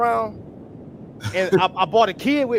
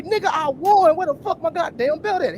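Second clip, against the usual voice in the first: retold indignant insistence; 10 semitones higher than usual. Knocked-out fighter tells little partner he won, mock indignant. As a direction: insist on it, high and incredulous.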